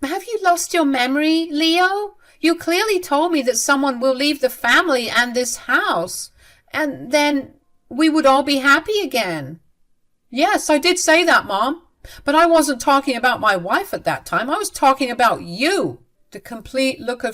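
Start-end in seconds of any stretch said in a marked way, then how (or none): 0.0-9.6 high-pitched exasperated mother; acted indignant arguing; frustrated insistence. Frustrated mother keeps arguing with son. 10.3-16.0 raised voice, arguing back; acted exasperated son; heated retort. Son snaps back at mother.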